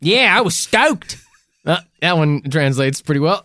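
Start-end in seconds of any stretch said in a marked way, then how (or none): 0.0-1.2 slow, drawn-out Aussie voice; mock Australian accent. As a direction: stretch it out like a caricature Aussie. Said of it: "this authentic Australian accent".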